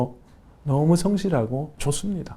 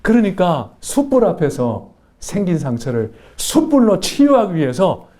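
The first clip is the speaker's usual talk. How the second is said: rising, building sermon cadence; fervent preaching; 7 semitones higher, 4 dB louder than usual. Acted. Pastor preaching to the congregation, building fervour. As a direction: preach it to the congregation with growing fire.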